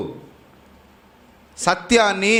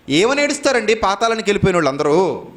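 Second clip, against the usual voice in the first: ordinary talk, nothing marked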